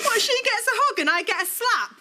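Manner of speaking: high, aggrieved complaint; indignant outrage. Acted. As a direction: protest at the unfairness. Daughter protests during family row.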